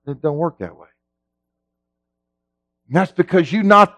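ordinary delivery

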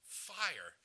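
"Fire" pitched high and loud, falling off; a loud, isolated FIRE with a falling tune; emphatic single word. Shown as a demonstration. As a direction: punch "Fire" on its own. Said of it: "He's emphasizing that it's fire".

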